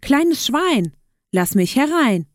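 high first phrase, dropping on the second; calling out. A narrator's reading. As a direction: call to the little pig at the door.

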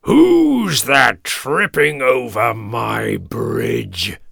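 loud, slow, drawn-out troll roar; big stretched-out monster roar. A narrator's reading. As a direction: bellow slowly as a menacing troll. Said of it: "roared the troll".

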